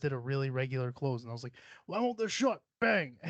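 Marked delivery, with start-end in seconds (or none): 1.9-3.2 high, exasperated retold yell; mock angry outburst. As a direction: act out a frustrated slam, pitched up. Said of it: "it seems like I have anger issues".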